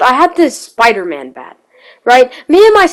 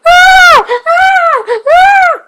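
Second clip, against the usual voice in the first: much higher, louder retold bawling wail; loud crying wail; 4 dB louder, much slower than usual. Kid retelling his bloody nose, crying loudly. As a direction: wail it out like a hurt four-year-old. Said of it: "I'm just crying very loudly".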